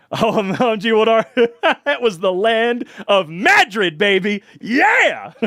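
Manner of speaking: loud, high, laughing cheer; giddy whoop building to a yell; excited laughing. Real, not acted. Laughing, excited outburst about series.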